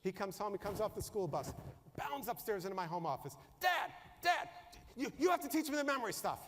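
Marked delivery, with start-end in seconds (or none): 3.6-6.4 put-on excited kid. Excited son bursting into office.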